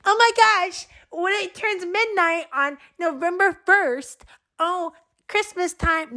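high, squealy mock excitement over Christmas; mocking gushing fan. Mocking people online who start Christmas on November first, high and gushing. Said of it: "everyone online is like".

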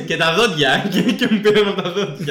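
high-pitched, talking through laughter; amused, laughing hard between phrases. Real, not acted. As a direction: crack up while getting the joke out. Cracking up at own joke.

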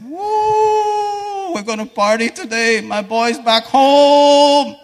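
loud, high, overjoyed cry of welcome; joyful father. Retold or imitated. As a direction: whoop it out, the father bursting with joy. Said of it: "hugged him and said".

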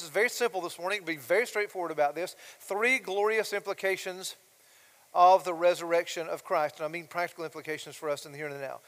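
ordinary talk

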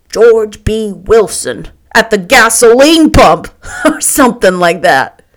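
loud, brash man inventing as he goes; Tom, jovially mocking. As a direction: boom it out, amused at your own joke.

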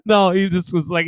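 ordinary delivery

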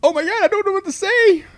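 high, loud flustered panic; panicked. Retold or imitated. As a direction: blurt it out caught and scared. Retold panicked reaction.

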